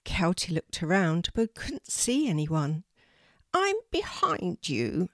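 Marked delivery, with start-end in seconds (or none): 3.5-5.1 high, slow bird voice; a strange high raven calling; unusual voice. A narrator's reading. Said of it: "said the voice".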